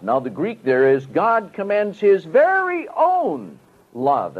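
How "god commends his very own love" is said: slow, with very own pressed hard and higher; emphatic. Shown as a demonstration. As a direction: lean on "very own" and draw the phrase out. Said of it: "And that's very emphatic in the Greek".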